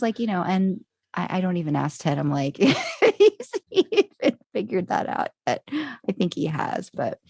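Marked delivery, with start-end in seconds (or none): 2.6-5.3 high, slow, laughing words; laughing aside. Retold or imitated. Wife joking about Ted, high laughing delivery. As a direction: get it out through laughter.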